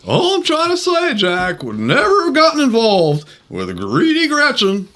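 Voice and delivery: high, jittery nervous comic voice; Don Knotts impression. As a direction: play it twitchy and flustered. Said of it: "play Don Knotts".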